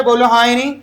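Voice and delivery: ordinary delivery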